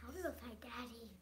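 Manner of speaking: quick whispered aside. Real, not acted. Child answering parent in a whisper. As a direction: whisper it fast.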